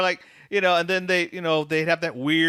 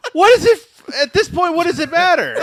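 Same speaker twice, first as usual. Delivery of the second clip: laughing; 11 semitones higher, 8 dB louder, faster than usual. Retold or imitated. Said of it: "it's like".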